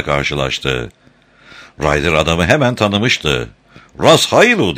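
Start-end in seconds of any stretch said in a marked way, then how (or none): 4.0-4.8 high, louder call of a name; narrated shout, pitched well up; shouted greeting. A narrator's reading. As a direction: call out to a man across the deck. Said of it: "diye bağırdı".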